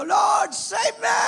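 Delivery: high, drawn-out yell for rescue; desperate shout. Acted. Falling missionary crying out to God, desperate shout. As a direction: scream it slowly mid-fall, hammed up.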